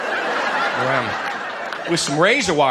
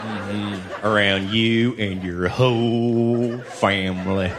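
mock pious prayer; 7 semitones lower, slower than usual. Mock pious prayer, low slow.

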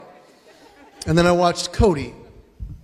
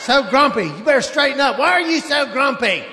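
higher, mock scolding yell at the kids; hammed-up scolding mother; yelling mom; 6 semitones higher than usual. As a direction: nag the grumpy kids at two a.m. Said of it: "just yelling at the kids".

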